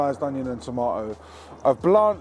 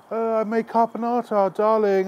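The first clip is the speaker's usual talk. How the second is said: high, airy posh affectation; posh dinner-party voice; 8 semitones higher than usual. Retold or imitated. Mock posh guest presenting her dish. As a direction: swan in with it like a posh host. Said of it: "be like".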